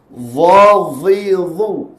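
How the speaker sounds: loud, full "Dha, dhi, dhu" with heavy dha; practice drill. Shown as a demonstration. Teacher models the drill on dha, full heavy "Dha, dhi, dhu". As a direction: recite "Dha, dhi, dhu" clearly for repeating. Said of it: "kita latih pada huruf dha".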